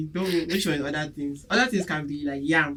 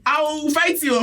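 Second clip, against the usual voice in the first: high, loud playful outburst; mock angry threat; 11 semitones higher, 5 dB louder than usual. Host warns people bragging about heat, mock angry threat. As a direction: threaten them in hammed-up outrage.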